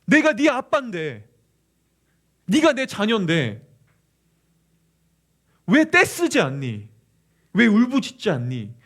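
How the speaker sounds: loud, slow, heartbroken pleading; anguished father. Acted. God as father to a child who won't lean on him, anguished. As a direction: cry out to your child, drawing out each question. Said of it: "안타까워하시면서 그분은 더 우십니다".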